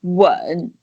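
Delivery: "Wen" pushed low from the throat, dipping; low dip-and-rise "Wen"; third tone, throat effort. Shown as a demonstration. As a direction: put effort in the throat as "Wen" dips and rises. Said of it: "you put efforts in your throat".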